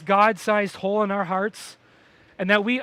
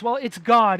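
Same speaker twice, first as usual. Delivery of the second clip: normal talking voice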